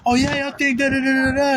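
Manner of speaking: high-pitched, hectoring rant; put-on anger. Shown as a demonstration. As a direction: switch into a projected angry coach rant. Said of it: "Ravisher mode".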